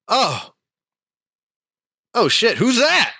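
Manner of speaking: high, loud, acted surprise; hammed-up startled shout. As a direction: yelp it out on suddenly spotting strangers. Startled smith notices the visitors.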